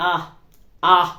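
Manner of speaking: "আহ" as a short open vowel, said alone; short U sound. Shown as a demonstration. Teacher showing U's phonic sound, "আহ" as an isolated open vowel. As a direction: sound out "আহ" twice, pausing between. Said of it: "যেটা ফনিক sound".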